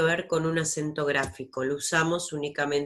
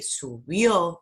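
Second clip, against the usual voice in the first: subió slowly, with a strong, long BIÓ; final-syllable stress; 3 dB louder than usual. Shown as a demonstration. Teacher giving examples of agudas, final stress on subió. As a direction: swell on the end of subió. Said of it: "tienen la última sílaba".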